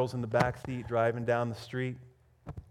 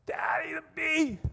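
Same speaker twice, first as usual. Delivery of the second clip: very high shrieked imitation of a child; imitating a terrified girl's scream; freaking-out little girl; 13 semitones higher than usual. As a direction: squeal it in mock panic. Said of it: "the little girl starts freaking out again".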